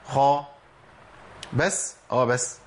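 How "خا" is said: خا with breath running through the خ; خاء مفتوحة مهموسة; kha with fatha. Shown as a demonstration. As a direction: say خا with audible air on the خ. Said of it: "الخاء المتحركه بفتح".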